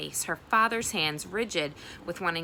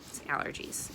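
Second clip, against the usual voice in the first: whispered excuse; 7 dB quieter than usual. Acted. Janny's excuse.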